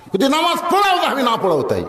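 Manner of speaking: high, exasperated imitation falling away at the end; mock angry retort. Nagged man refusing the call to prayer, mock angry retort. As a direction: snap back in hammed-up annoyance. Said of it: "ওই রাগ করে কয়".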